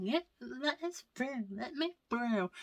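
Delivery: high mock pleading; lightly mimicked pushy kids. Kids shoving toward Mickey, mock high-pitched pleading. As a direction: lightly put on a pushy child's voice.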